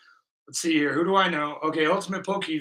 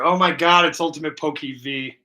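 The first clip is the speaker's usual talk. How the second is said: ordinary delivery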